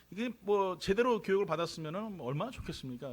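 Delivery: usual speaking voice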